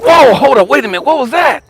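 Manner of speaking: whoa, caught off guard by a chill; startled. Retold or imitated. Alone in his office, startled by a sudden chill.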